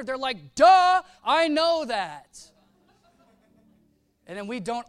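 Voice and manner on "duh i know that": loud, high, slow sarcastic duh; mock duh. Sinner answers church's scolding with a loud, slow sarcastic duh. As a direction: drag it out like it's obvious.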